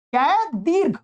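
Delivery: ordinary talk, nothing marked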